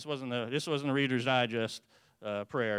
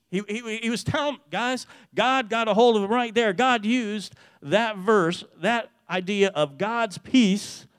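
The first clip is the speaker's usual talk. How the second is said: ordinary talk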